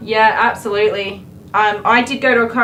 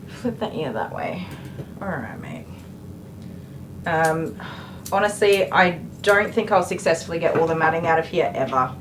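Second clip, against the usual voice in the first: ordinary talk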